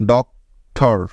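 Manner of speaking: Doctor pulled apart, a long pause between ডক and টর; split into syllables. Shown as a demonstration. Teacher showing a word's two syllables, spaced well apart. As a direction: leave a wide gap inside doctor, after ডক. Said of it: "একবার থামতে হচ্ছে".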